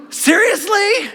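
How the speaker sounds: gasp, then high, drawn-out disbelief; incredulous gasp. Acted. Preacher playing Jesus hearing the man's request, incredulous. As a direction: stretch it out, scandalized and disbelieving.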